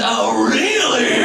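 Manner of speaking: slow, booming mock God; God's mock reply. God mocking a birthday celebrant, in a slow booming voice. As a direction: drag it out like an offended God. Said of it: "God goes".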